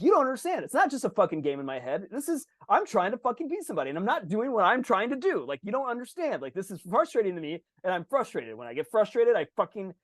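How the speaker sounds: high, heated protest; a kid defending his temper to mom; frustrated kid. Retold or imitated. Young self arguing with mom.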